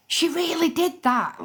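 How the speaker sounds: high, drawn-out incredulous reaction; acted disbelief. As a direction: replay your astonishment, pitched right up. Acts out her disbelief at stories.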